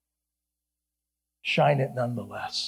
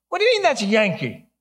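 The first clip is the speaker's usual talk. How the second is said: higher-pitched indignant protest; mock incredulity; 8 semitones higher, 4 dB louder, faster than usual. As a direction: act out a friend's baffled objection. Friend's retold protest to the janitor.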